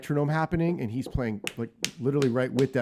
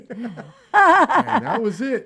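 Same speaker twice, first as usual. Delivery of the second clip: laughing; 13 semitones higher, 12 dB louder, much slower than usual. Real, not acted. Laughing at the memory.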